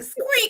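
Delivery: imitated high bat squeak; thin, high animal squeal. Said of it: "every time the bat squealed".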